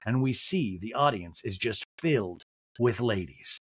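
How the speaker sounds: ordinary delivery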